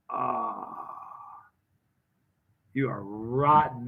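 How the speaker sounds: low, slow, drawn-out scolding; retold exhausted relief; relieved reproach. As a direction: sigh it out, low and slow, at the prankster. Retold relief on realising the prank.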